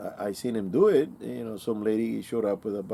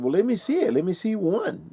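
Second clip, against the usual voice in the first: eager; 10 semitones higher, 4 dB louder than usual. Retold or imitated. Eager to see the rifle.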